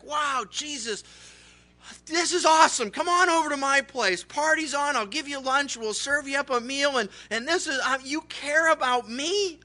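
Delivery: high, loud, overjoyed gushing; acted thrilled disbelief; joyful. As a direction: gush with amazed gratitude to an honored guest. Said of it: "joyfully. So he's like".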